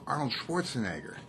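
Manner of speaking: ordinary talk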